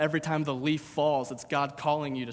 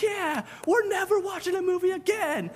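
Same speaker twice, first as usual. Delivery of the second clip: much higher, hammed-up fired-up crowd; mock crowd cheer. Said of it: "They're like".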